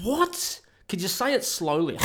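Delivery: high, loud comic disbelief; baffled outburst. Retold or imitated. Learner acting out bafflement at Dutch pronunciation, high and incredulous. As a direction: jump up in pitch on an incredulous "what".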